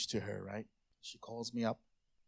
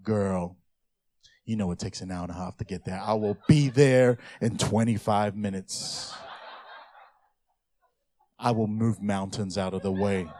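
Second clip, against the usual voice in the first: R&B bass singer; 6 semitones lower, 5 dB louder than usual. Acted. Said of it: "usually the bass singer with the cane comes out".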